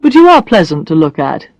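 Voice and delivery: a hint of a blunt man, teasing; Brad's voice. Brad teasing Alex about her looks, lightly voiced man.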